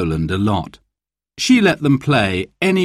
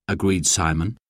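ordinary delivery, nothing marked

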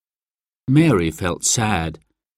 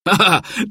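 hearty uncle's chuckle; a quick delighted laugh; happy laugh; 9 semitones higher than usual. A narrator's reading. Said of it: "laughing happily".

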